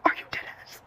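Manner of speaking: whispered, stunned aside; disbelieving whisper. Real, not acted. Storyteller to viewers about the man crawling over, disbelieving whisper.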